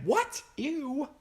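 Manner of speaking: sudden very high, loud squeal of shock; disgusted shriek. Acted. Valor the dragon finding a dragon cookbook, high disgusted squeal. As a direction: recoil from the book with a squeaky yelp.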